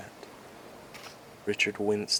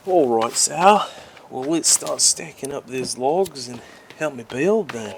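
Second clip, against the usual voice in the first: loud and higher, rallying; eager call; 5 semitones higher, 10 dB louder than usual. Acted. Richard rallying Sal to stack logs, loud and eager. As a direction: call your mate to get to work.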